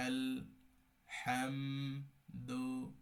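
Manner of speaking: আল হাম দু split into separate syllables with pauses. Shown as a demonstration. Teacher splitting the word for learners, syllable by syllable. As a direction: break আল, হাম, দু apart, each its own beat. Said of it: "পিরায় পিরায় আলাদা করে দেওয়া".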